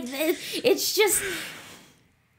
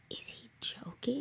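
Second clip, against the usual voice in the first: a whispered, higher-pitched "is he joking"; stunned whisper; 5 semitones higher, 14 dB quieter than usual. Retold or imitated. Recalling her frozen thought to a friend, whispered. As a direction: breathe the question in disbelief.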